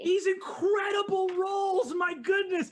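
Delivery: thrilled game master; excited. Real, not acted. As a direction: gush over the dice roll. GM hyped by big roll.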